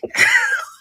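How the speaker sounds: squeaky high burst of laughter; high giggle. Real, not acted. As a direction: let the giggle out.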